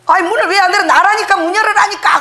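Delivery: high and loud, fed up; why won't you open, shouted; exasperated yelling. Retold or imitated. Locked-out husband shouting at his wife in frustration.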